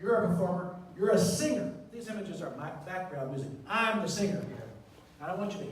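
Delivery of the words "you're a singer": usual speaking voice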